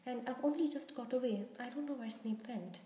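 ordinary delivery, nothing marked

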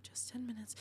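murmuring to herself again while waiting. Acted. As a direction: murmur it, nearly silent. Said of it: "she told herself".

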